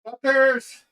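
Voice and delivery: high-pitched cry of "Fuckers!"; yelled insult. Real, not acted. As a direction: shout it at the absent viewers. Yells at chat who left.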